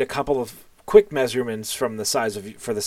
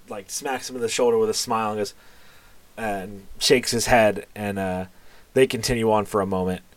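usual speaking voice

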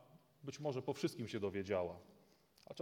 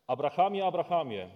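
loud, high call from heaven; urgent call; 5 semitones higher, 12 dB louder than usual. Acted. Angel calling from heaven to stop Abraham, loud.

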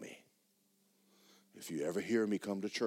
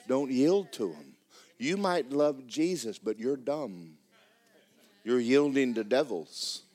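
preaching; 4 semitones higher, 7 dB louder than usual. Acted. Preacher warning the congregation against devils, loud. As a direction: drive the warning home to them.

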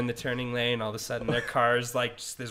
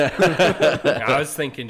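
slow and laughing, louder; 4 semitones higher, 13 dB louder, much slower than usual. Real, not acted. Friend starts a crude joke, laughing. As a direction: laugh out the setup.

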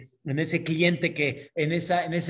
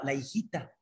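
ordinary talk, nothing marked

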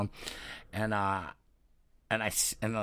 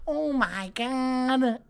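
much higher, very drawn out; mock embarrassed girl apologising; gushing apology; 13 semitones higher, much slower than usual.

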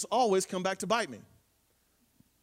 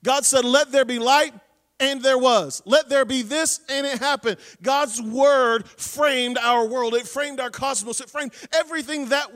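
louder, driving sermon voice; preaching at full force; 3 semitones higher, 6 dB louder than usual. Acted. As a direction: preach it out to the congregation. Preacher retells creation with fervour.

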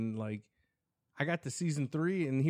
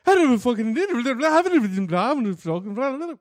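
wired friend; 4 semitones higher, 7 dB louder than usual. Retold or imitated. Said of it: "Comes back like".

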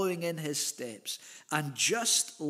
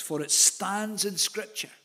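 "stands" raised and stretched out slowly; stress on stands; 4 semitones higher than usual. Shown as a demonstration. Preacher pointing listeners back to verse six, "stands" stressed high and slow. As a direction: lift and lengthen the word "stands". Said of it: "I love that emphasis on God's word standing".

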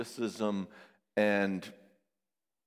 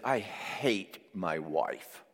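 low, slow and soft bitter line; bitter client; 4 semitones lower, 5 dB quieter, much slower than usual. Retold or imitated. Client telling the therapist about his wife, low slow bitterness. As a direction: drag it out like a sullen man.

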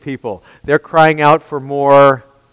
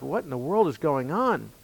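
bewildered murmur; 15 dB quieter than usual. Retold or imitated. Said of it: "looking at each other like".

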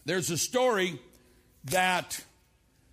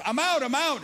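excited call; 10 semitones higher, 6 dB louder than usual. Retold or imitated. Campers calling out in maze.

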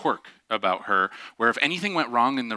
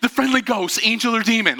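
hint of an urgent kid; 10 semitones higher, 4 dB louder than usual. Child insists.

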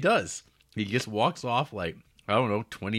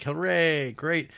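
mock cheer; 8 semitones higher, 4 dB louder, slower than usual. Monks cheer.

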